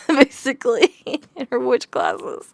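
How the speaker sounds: laughing. Real, not acted. Laughing at character's bad schooling.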